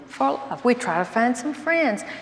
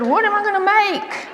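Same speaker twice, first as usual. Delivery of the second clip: much higher, baffled question; incredulous; 10 semitones higher than usual. Retold or imitated. Wife asking husband what she'd sew, baffled and high. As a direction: squeak it out in disbelief.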